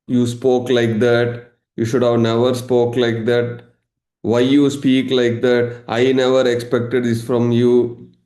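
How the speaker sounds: slow, droning mock reproach; mock emotional drama. Emotional drama person reproaching the listener, slow mock-wounded lament. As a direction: act out a drawn-out guilt trip. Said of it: "Emotional drama".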